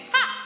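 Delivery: short sharp "Ha!" from the diaphragm. Shown as a demonstration. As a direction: bark out a quick "Ha!". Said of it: "Can we all just go".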